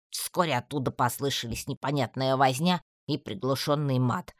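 plain reading voice

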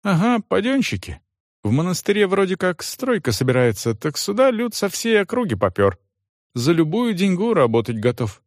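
servant Johan. Acted.

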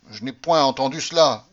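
loud, emphatic denial on point; surprised outburst. Acted. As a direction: blurt it out, taken aback. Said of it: "surpris du ton". (dénégation forte et surprise)

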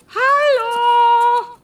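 loud high call into emptiness; shouting. Acted. Stranded traveller shouts for people in the deserted city, high and loud. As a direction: yell hallo as if no one can hear. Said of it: "schreien hilft uns auch nicht weiter".

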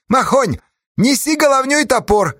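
high-pitched, ringing order; commanding. A narrator's reading. Merkul orders Makhon to fetch the axe, commanding. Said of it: "велел Меркул".

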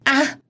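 a sudden high shriek; piercing scream. Acted. The young wife screams after slapping herself, shrieking. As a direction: scream out in shock. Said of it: "Sau đó hét lớn".

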